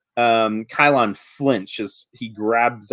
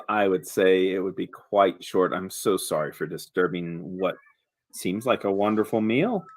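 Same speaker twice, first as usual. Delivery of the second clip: lower, soft, unhurried politeness; lackadaisical creature, apologetic; 6 semitones lower, 6 dB quieter than usual. Acted. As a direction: apologize smoothly and quietly, in no hurry.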